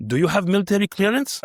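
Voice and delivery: mock Russian guard checking papers; imitated Russian guard. Russian guard questions the visiting doctor, in a Russian accent. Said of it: "there's a Russian guard and he goes".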